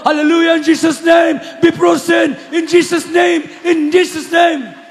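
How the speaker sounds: high, loud and slowed, each word hammered; retelling a bellowed, slowed command to freeze; shouting the prayer. Retold or imitated. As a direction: roar it out like a rebuke. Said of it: "Sigaw ako ng sigaw".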